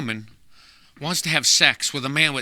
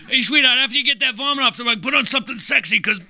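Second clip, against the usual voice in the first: cheesy lover call; 7 semitones higher than usual. Retold or imitated. Guy calls to wife.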